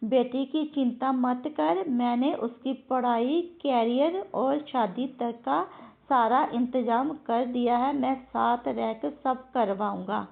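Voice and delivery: slowly reads out the thumbnail quote; slow reading. Shown as a demonstration. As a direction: spells out each line of the thumbnail, slow. (धीरे-धीरे पढ़ा गया)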